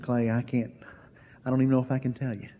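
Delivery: hushed, shaken retelling of a friend; soft trembling friend on the phone. Retold or imitated. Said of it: "her voice was trembling".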